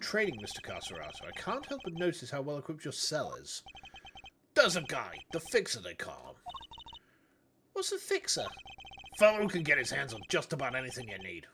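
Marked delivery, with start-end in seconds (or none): 4.5-11.5 gruff Bronx tough-guy con; thick Bronx accent. Acted. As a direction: lay on a heavy Bronx accent. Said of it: "such a thick Bronx accent".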